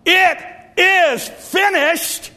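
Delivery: loud, high, slow cry of It is finished; preacher crying out Jesus' last words; cried out. Retold or imitated. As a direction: cry it out like from the cross. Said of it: "When Jesus cried from the cross".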